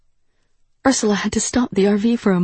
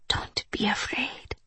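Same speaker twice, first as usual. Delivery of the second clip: slow, hushed whisper; 3 semitones higher, 11 dB quieter, slower than usual. A narrator's reading. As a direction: whisper it slowly and very softly. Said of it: "Duho whispered".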